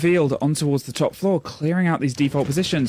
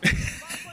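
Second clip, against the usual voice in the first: giggle; 5 dB quieter than usual. Real, not acted. Laughs at own impression.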